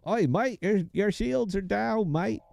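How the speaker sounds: high, badly faked Aussie; mock Aussie accent. Aussie computer warning the player about shields, mock Australian accent. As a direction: warn like a hammed-up Aussie ship computer.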